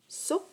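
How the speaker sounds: "so" sung out high with a crisp S; teacher showing the sound of C cédille O; demo sound. Shown as a demonstration. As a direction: lift "so" high and clear. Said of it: "ça fait".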